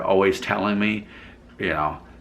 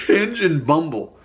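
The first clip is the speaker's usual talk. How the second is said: amused, laughing; 7 semitones higher than usual. Real, not acted. Repeats the app names amused.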